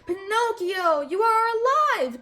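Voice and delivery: high, loud joy; joyful gasp. A narrator's reading. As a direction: cry out with amazed joy. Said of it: "gasped Geppetto with joy".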